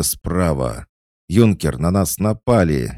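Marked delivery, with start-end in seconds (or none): 1.3-2.9 cheerful yell, louder and higher; playful shout. A narrator's reading. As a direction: call out the alarm in fun. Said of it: "весело закричали кошка и лиса".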